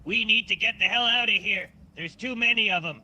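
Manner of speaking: rally the crew, pushed up high; urgent leader voice. Acted. Jackson Steele voiced by player.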